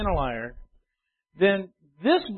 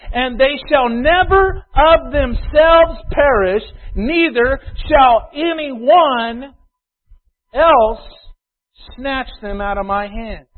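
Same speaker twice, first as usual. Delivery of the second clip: slow, loud emphatic reading; preaching scripture; 4 semitones higher, 6 dB louder, slower than usual. Acted. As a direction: pulpit proclamation, words stretched. Preacher reads corrected scripture forcefully.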